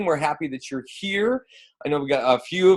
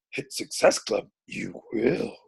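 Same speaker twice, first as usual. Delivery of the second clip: softer, put-on little alien master voice; Yoda; 7 dB quieter, slower than usual. Host retrying the welcome for the coaches, Yoda impression. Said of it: "let me try it again".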